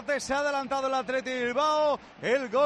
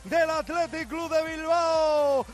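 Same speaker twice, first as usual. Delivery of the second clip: drawn-out goal shout; 3 semitones higher, much slower than usual. Real, not acted. Goal call.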